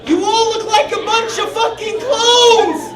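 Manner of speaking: very high, slow, strained howl; crazed yell. Retold or imitated. Steve screaming at the hot girls, very high and slow. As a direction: howl it at them like a madman. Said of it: "And he's like".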